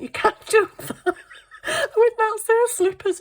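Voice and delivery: high, loud protest broken by laughter; giggling through the telling-off; laughing protest. Retold or imitated. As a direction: tell him off while cracking up. Scolding dad while laughing.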